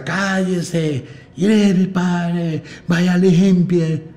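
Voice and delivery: high, slow, drawn-out yelling mother; shrill operatic mom shouting slowly at the kids; mother yelling orders. Retold or imitated. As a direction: bellow it like a bossy mom at home. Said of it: "necesita entrenar la garganta y gritar".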